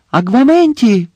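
high, loud incantation; shouted spell. A narrator's reading. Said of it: "крикнув він".

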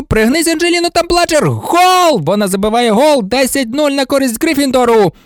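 high-pitched live play-by-play; thrilled partisan sportscaster; excited match commentator. Acted. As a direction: call the action breathlessly up to a goal cry.

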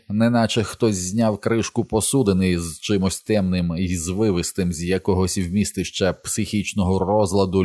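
ordinary delivery, nothing marked

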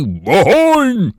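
drawn-out battle cry; shouted command. A narrator's reading. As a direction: shout the order to the firing line. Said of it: "щоб крикнути". (протяжний командний вигук)

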